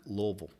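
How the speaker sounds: "Louisville" said as a clipped LOO-vul; local Louisville pronunciation. Shown as a demonstration. Telling a rock singer where he's from, in a local Louisville pronunciation. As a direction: say "Louisville" the Kentucky way, two mushed syllables. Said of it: "that's how you would say Louisville".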